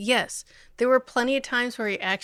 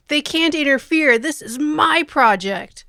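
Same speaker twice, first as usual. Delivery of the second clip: higher, loud acted objection; mock territorial outburst; indignant protest; 7 semitones higher, 9 dB louder than usual. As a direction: protest loudly, staking a claim. Retold character objects to interference.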